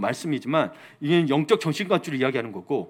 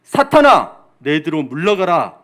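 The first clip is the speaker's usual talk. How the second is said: stern rebuke; 8 dB louder, slower than usual. Acted. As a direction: cry out a scriptural rebuke with full authority. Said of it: "베드로를 꾸짖어 이르시되". (크게 꾸짖듯이)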